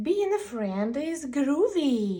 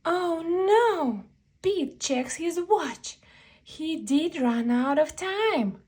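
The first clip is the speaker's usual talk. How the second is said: higher, louder alarmed reading; dramatic dismay; 6 semitones higher, 4 dB louder than usual. A narrator's reading. Reader tells kids Pete ran late, alarmed and loud. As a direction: read it like a big oh no.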